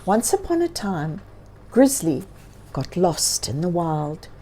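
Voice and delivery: usual speaking voice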